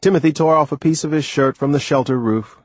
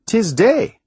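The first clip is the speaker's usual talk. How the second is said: high, surprised island voice; a puzzled old sailor, pitched up; puzzled Timothy; 11 semitones higher, 4 dB louder than usual. A narrator's reading. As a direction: blurt it out in disbelief. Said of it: "There was puzzlement in his voice".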